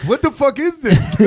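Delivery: high, loud cry of disbelief; mock outrage. Acting a viewer seeing the wrong hashtag, mock outrage. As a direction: burst out in comic, high-pitched bafflement. Acts out shocked reaction to hashtag.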